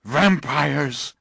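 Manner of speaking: loud outburst on Vampires!; outraged cry to his friend; bursting out. Acted. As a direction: blurt Vampires! out in disbelief. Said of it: "he burst out".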